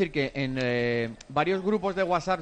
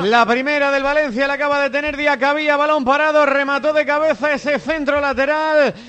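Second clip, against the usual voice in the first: high, chanting football commentary; radio commentator narrating a chance as it happens; live play-by-play; 9 semitones higher, 8 dB louder, slower than usual. Real, not acted. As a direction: call the action with drawn-out, sustained high notes.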